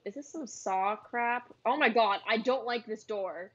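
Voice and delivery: ordinary talk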